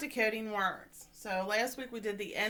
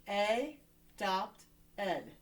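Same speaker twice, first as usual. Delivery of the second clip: "A, dopt, ed" in three separate beats; split by syllable; much slower than usual. Shown as a demonstration. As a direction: sound out "A", "dopt", "ed" one at a time. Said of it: "we're gonna split it here".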